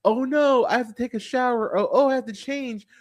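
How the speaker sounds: hammed-up ditzy complaining; mock whiny movie heroine. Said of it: "several scenes of".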